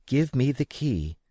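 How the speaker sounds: a hint of the mother, brisk; mother asking for the key; mother's voice. A narrator's reading. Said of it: "said my mother".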